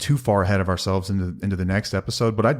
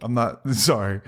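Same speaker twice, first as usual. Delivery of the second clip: laughing; slower than usual. Real, not acted. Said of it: "I'm over here laughing".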